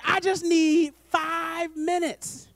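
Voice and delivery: very slow, drawn-out plea; God asking gently. Acted. God asking the late-night phone talker for time, slow plea. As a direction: stretch out each word like a patient request. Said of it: "God is like".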